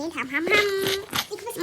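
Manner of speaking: high, drawn-out nom-nom-nom; pretend munching. Urging dad to eat, pretend munching. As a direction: mime gobbling with slow hums.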